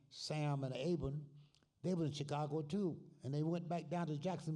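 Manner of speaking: ordinary talk, nothing marked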